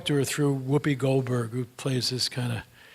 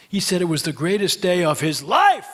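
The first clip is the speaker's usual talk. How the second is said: triumphant exclamation; 4 semitones higher, 9 dB louder than usual. Retold or imitated. Boy finally beats his grandmother at Monopoly, triumphant exclamation. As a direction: crow it like a victory, stretching the last word.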